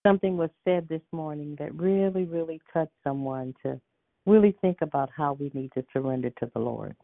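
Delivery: ordinary delivery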